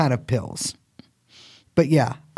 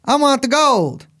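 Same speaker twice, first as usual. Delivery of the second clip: higher, a mock leprechaun from a viral clip; leprechaun video quote; 8 semitones higher than usual. Host quoting the leprechaun video to his cohost. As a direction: quote the leprechaun gold line in a squeaky voice. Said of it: "You guys haven't seen the leprechaun video".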